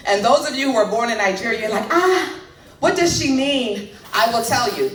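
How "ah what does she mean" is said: put-on Nigerian accent. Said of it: "I know my accent is terrible".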